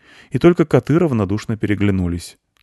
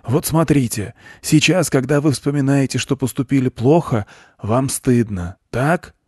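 raised, earnest lecturer's voice; preaching goat; 7 semitones higher, 3 dB louder than usual. A narrator's reading. Goat explains his doctrine to a boy, preaching. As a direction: expound it like a guru, pitched up.

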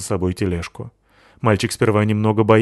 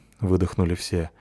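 usual speaking voice

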